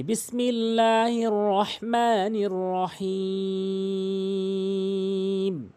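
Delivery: slow, drawn-out chanted recitation; Quran recitation. Shown as a demonstration. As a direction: recite it slowly like tilawat.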